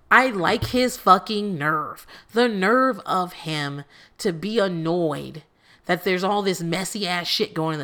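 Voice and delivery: slow, pointed disbelief; slow indignant. Real, not acted. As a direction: spell out your outrage word by word. Incredulous rant, slowed.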